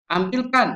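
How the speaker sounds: AMBILKAN high and loud all through; command intonation. Shown as a demonstration. Teacher showing students how a command sentence sounds, commanding. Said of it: "nadanya beda dengan kalimat perintah".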